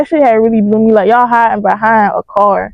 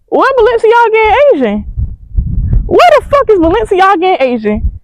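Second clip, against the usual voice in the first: high-pitched, bewildered searching question; baffled; 9 semitones higher than usual. Retold or imitated. Narrator looking back for her missing friends, baffled. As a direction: retell it as a puzzled, high-pitched outcry. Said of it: "I'm confused as a fuck".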